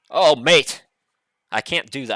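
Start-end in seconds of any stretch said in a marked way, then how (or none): 0.1-0.8 put-on loud, higher go at an English accent; attempted English accent. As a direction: imitate an excited coach with a rough English accent. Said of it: "I can't do the English accent".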